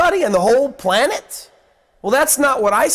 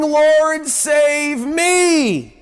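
raised, pleading call for rescue; crying out for help; 6 semitones higher, much slower than usual. A narrator's reading. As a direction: cry out to be saved from sinking. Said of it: "he cried out".

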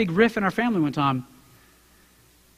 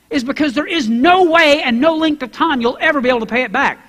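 ordinary talk

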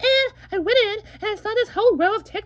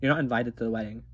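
low dismissive voice; 4 dB quieter, much faster than usual. Acted.

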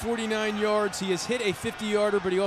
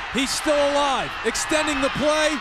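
excited play call; 6 semitones higher than usual. Real, not acted. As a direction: ride the tension of the scramble. Scramble play, live call.

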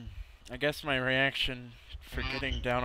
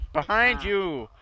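sharp, higher, louder warning call; shouted warning; 7 semitones higher, 6 dB louder, slower than usual. Real, not acted. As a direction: yell it so they turn around. Urgent warning to teammate.

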